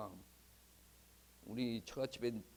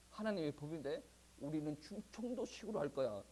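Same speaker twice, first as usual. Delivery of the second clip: ordinary talk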